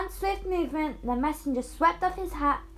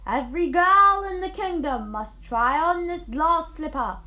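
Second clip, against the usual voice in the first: loud, formal crier's announcement; proclamation; 4 semitones higher, 8 dB louder than usual. Acted. Messenger announcing the prince's order to the street, loud and formal.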